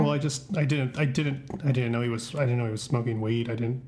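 low, soft and broken; quietly crushed; devastated. Acted. Father devastated about son.

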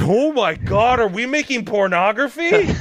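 high-pitched, scandalized disbelief; shocked outburst. Retold or imitated. Voice actor realizing what he is dubbing, shocked outburst. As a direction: blurt it high in mock horror. Retold shocked discovery in the booth.

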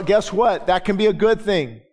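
ordinary delivery

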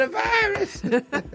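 high, drawn-out squealing cry; [name] impression. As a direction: scream the word like [name]. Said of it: "And she said".

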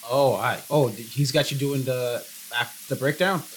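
ordinary talk, nothing marked

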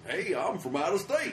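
high, loud hammed-up American tourist; brash American tourist. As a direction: announce yourself like a clueless tourist. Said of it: "I'm so American".

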